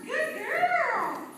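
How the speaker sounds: squeaky dog praise; high happy praise. Real, not acted. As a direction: gush at the dog.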